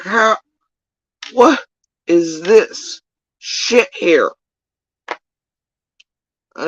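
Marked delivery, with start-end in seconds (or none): 0.0-4.3 retold outrage. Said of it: "I was like".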